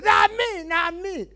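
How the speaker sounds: protesting at full voice; high-pitched shout. Acted. Comedian explaining his stuck loud voice, high shout.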